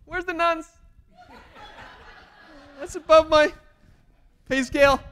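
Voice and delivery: high, loud flustered yelp; mock panic. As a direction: squeal like someone way out of his depth. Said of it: "I was like".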